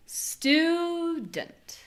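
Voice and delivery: exaggerated drawn-out "stuuu-dent"; stretched stressed vowel. Shown as a demonstration. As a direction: stretch the first vowel of "student" way out. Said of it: "stretching the vowel of stew".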